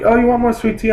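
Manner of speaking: high, sing-song fake politeness; mock-sweet waitress. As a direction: ape her fake-nice offer up high. Waitress offering refill, mocked.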